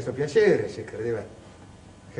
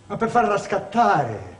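high, loud, building urgency; higher and louder, gathering heat; rising agitation; 8 semitones higher, 5 dB louder than usual. Acted. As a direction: drive toward the outburst. Recounting provoking her to erupt.

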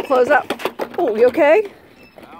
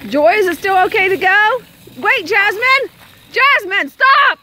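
urgent high, loud yell; real yelling; 11 semitones higher, 8 dB louder, slower than usual. Real, not acted. Adult stopping Jasmine near the road, yelling. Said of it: "Didn't mean to yell".

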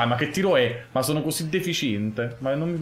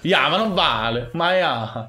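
excited protest; 4 semitones higher, 4 dB louder, slower than usual. Real, not acted. Car glitches mid-game.

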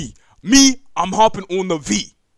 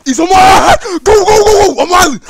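panicked yelling; 11 semitones higher, 14 dB louder than usual. Real, not acted. Fleeing a chasing monster.

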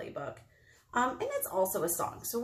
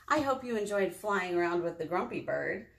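ordinary delivery

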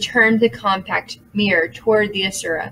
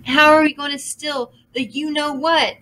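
panicky; 6 semitones higher than usual. A narrator's reading. Minnie panicking continues.

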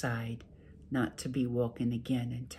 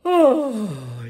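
big yawn; 9 semitones higher, 14 dB louder than usual. A narrator's reading. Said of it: "he yawned".

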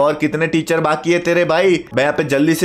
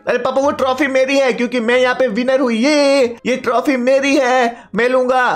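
put-on higher-pitched kid claiming a prize; high, insistent kid's voice; insistent son; 7 semitones higher than usual. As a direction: play the son staking his claim with papa.

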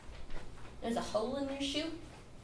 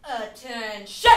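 slow, drawn-out loud command; drill sergeant; 2 semitones lower, 6 dB louder, much slower than usual. Acted. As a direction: call the line to attention. Drill sergeant call to line.

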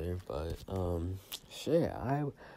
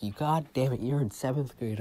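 higher, louder scolding; scolding grandma; 5 semitones higher, 4 dB louder than usual. Retold or imitated. Grandma scolding her grandson, mock angry. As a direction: scold the hungover kid. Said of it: "And she's like".

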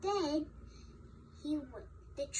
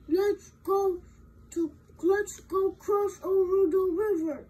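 loud, higher big-goat voice; 4 semitones higher, 9 dB louder than usual. Acted. Big goat urging his brothers across the river, loud character voice. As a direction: call out loudly as the big billy goat.

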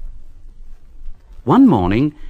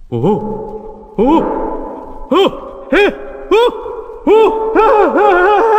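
high, rising panicked yelps growing louder; frightened shouts; 4 dB louder, much slower than usual. A narrator's reading. Workmen fleeing the tunnel, high scared yelps. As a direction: cry out in fright, climbing higher. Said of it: "they all shouted".

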